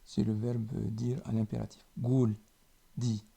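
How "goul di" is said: usual speaking voice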